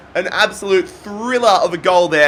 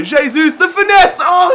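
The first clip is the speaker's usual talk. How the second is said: excited shout; 9 semitones higher, 5 dB louder than usual. Real, not acted. Shot curling toward goal.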